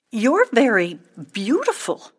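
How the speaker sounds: a touch shy; tentative. A narrator's reading. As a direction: venture it bashfully. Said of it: "ventured Boxwood".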